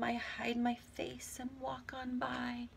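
bashful whisper; shy whisper. Shown as a demonstration. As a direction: hide the words like a shy kid. Shy feelings poem.

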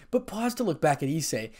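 plain reading voice

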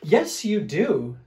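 Big Fluffy Bear. Acted. Fluffy bear arguing about a bath, loud insistence. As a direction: contradict firmly, drawing it out. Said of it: "said Big Fluffy Bear".

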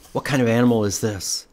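usual speaking voice

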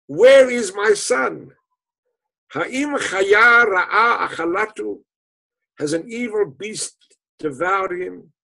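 loud, high, slow wailing questions; keen for the lost son; father crying out in despair, loud and slow; anguished cry. Acted. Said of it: "And he cried".

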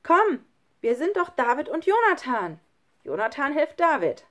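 high, loud encouragement; rally a hesitant friend from above; urging a friend. A narrator's reading. Friend urges the other up. (laut anfeuernd gerufen)

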